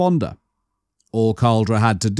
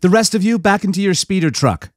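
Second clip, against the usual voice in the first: higher, brisk orders from a woman; commanding woman; 7 semitones higher than usual. Acted. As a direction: give orders without debate. Mara orders.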